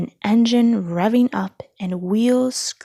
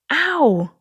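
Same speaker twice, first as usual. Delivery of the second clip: pained ow; 7 semitones higher, 4 dB louder than usual. A narrator's reading. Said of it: "said one of the cars".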